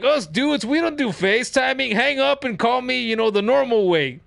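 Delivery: high-pitched tough-guy lecturing; Steve Austin. Retold or imitated. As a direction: scold him high, like a macho old-schooler. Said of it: "It's like".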